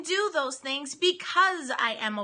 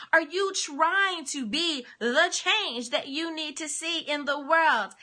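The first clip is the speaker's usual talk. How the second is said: normal talking voice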